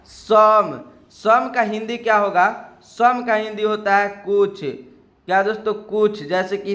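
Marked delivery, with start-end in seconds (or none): 0.0-0.8 "सम" said louder as "some"; teacher stressing "सम"; model pronunciation. Shown as a demonstration. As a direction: give "सम" firmly as the model. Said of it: "क्या उच्चारण होगा S O M E का?".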